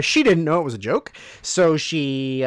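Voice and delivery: ordinary talk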